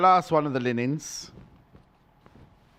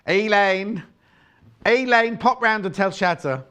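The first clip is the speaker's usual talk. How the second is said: calling out; 4 semitones higher, 5 dB louder than usual. Real, not acted. Calling out to colleague.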